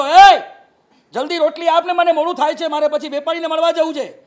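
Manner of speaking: loud, high demanding yell at the wife; impatient shout. Retold or imitated. Hungry husband yells at wife to hurry the roti, loud impatient shout.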